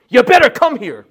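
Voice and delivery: loud, high mock command; demanding order. Preacher acting out how Jesus doesn't talk, demanding shout. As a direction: shout it like a harsh summons. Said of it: "Jesus doesn't talk like that".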